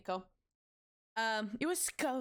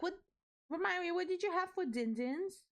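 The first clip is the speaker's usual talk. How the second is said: put-on baby talk; 8 semitones higher than usual.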